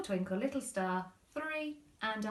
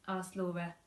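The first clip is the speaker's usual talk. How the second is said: ordinary delivery, nothing marked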